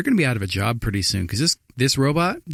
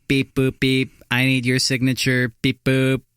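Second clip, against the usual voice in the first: robot voice; slower than usual. Pretend. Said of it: "be like".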